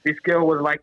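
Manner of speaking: ordinary delivery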